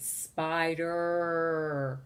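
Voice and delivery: "spider" with a very long final er; stretched end sound. Shown as a demonstration. Teacher confirms the ending, "spider" finishing on a long er. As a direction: hold the er of "spider" well past normal. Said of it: "You hear the er sound".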